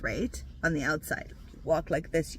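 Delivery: ordinary talk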